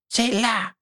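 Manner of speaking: very high-pitched pleading elf voice; squeaky elf whine. A narrator's reading. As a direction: whimper it high, like a begging little creature. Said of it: "gémit Créature, la voix suppliante".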